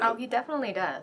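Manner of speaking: soft laughing aside; giggling agreement about the hitman. Acted. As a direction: chuckle it under your breath.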